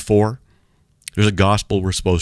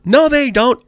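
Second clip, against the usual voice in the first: high, louder mock shout of denial; imitated yelling; 5 dB louder, slower than usual. Truck driver contradicting the preacher, imitated yelling. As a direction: act out a heckler shouting back. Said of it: "he's yelling at me".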